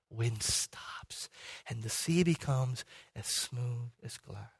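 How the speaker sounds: slow, low, very soft narration; hushed awe. Real, not acted. Preacher picturing the sea turned to glass, hushed. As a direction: let the voice settle into stillness. Narrating the sudden calm after storm.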